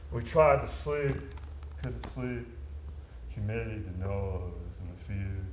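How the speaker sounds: low, soft night-time storytelling; subdued, fading narration; hushed recollection. A narrator's reading. Recalling sleepless, fearful jungle nights.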